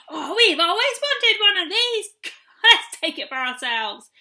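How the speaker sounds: loud, high greedy glee with a laugh; gleeful shout. A narrator's reading. Gnomes seizing the children's chair, gleeful shout. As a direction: crow over the prize. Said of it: "they shouted".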